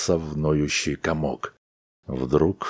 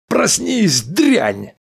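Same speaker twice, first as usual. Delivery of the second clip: furious shout; 6 dB louder, much slower than usual. A narrator's reading. Husband slaps wife awake.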